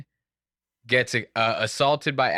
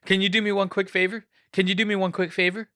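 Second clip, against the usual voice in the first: lightly raised, quick mock plea; mimicking Alec Baldwin; 7 semitones higher, faster than usual. Vlogger mimics Alec asking the harasser a favor, quick and higher. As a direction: toss off a hurried, higher-pitched plea. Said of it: "He's like".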